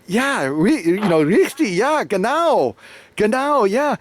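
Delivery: high, relieved yes-yes; finally understood, nodding along eagerly; high and eager, confirming the fan to the shopkeeper; eager agreement. Retold or imitated.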